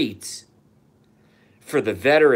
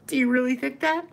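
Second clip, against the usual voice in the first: much higher, sing-song mocking voice; mocking taunt; 14 semitones higher, faster than usual. Ranter mocks viewers who trust school history, high taunting voice. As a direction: taunt them like silly children.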